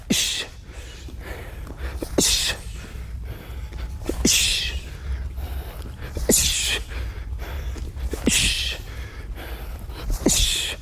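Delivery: short hissed breath with each strike; sharp exhale hiss. Shown as a demonstration. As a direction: hiss the air out on every kick. Exhales during kick drill.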